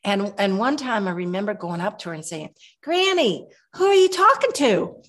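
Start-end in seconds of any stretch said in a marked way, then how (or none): 2.8-4.9 put-on much higher, small girl asking innocently; little child voice. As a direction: play yourself as a curious little kid. Child self calling to grandmother.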